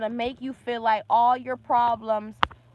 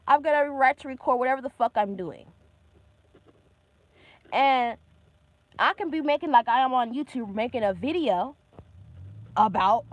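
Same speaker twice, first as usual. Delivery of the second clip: normal talking voice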